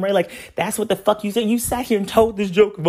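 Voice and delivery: ordinary talk, nothing marked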